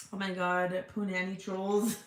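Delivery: normal talking voice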